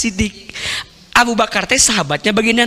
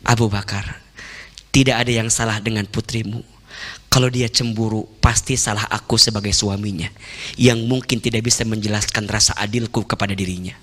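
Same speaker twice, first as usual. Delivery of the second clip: low, soft, calm reassurance; a quiet, sincere, fatherly voice; gentle wise prophet; 11 semitones lower, 5 dB quieter than usual. Acted. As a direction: speak tenderly, lower and softer, to calm the father. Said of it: "Apa kata Nabi?".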